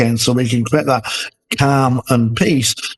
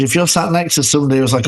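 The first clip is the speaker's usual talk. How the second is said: ordinary delivery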